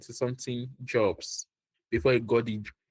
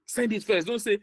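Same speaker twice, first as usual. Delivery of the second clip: ordinary talk, nothing marked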